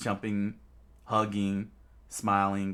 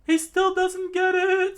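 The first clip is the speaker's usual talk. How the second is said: mocking Sanji; 11 dB louder than usual. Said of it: "Sanji said".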